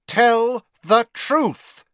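loud, high, drawn-out order; slow, forceful demand, each word hammered; ringing command. A narrator's reading. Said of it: "with a ringing force that was almost shocking".

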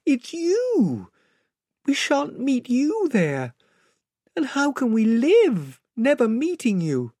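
put-on very high, wailing child voice; Lucy, tearful. Child who cannot bear losing the lion, tearful. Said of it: "sobbed Lucy".

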